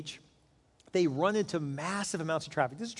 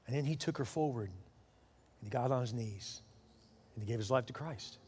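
low, soft, solemn narration; hushed storytelling; 5 semitones lower, 8 dB quieter than usual. Real, not acted. Preacher tells of a man's conversion, hushed and solemn. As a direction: let the climax sink to a hush.

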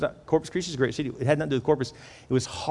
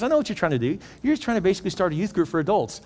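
acted enthusiasm; 6 semitones higher, faster than usual. Pastor excitedly understands his vision.

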